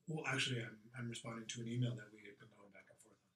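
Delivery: low, quick, offhand evasion; coy, understated reply to a gatekeeper; curt. Shown as a demonstration. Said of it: "the curt nature of my responses".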